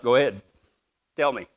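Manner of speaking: low, soft invitation; patient parent. Retold or imitated. Parent to kid, patient and quiet. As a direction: gently prompt a child to confess.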